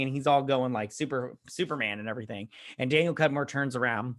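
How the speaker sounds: ordinary talk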